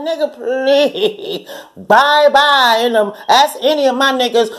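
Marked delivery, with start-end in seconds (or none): 0.1-2.8 laughing dismissal. Retold or imitated. To the man, a slow laughing dismissal. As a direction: laugh him out the door.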